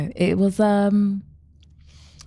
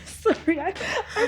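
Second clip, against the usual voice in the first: breaking into giggles; can't keep a straight face; laughing; slower than usual. Real, not acted. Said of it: "I can't keep a straight face".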